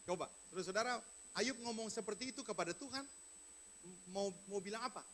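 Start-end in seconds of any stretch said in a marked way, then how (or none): none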